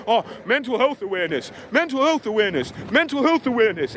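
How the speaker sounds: mocking slogan.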